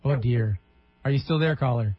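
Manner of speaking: ordinary delivery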